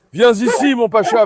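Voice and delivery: calling out. Real, not acted. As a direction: call him over across the yard.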